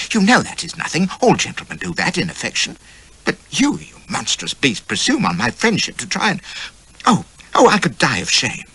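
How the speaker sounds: high indignant scolding; outraged lady. A narrator's reading.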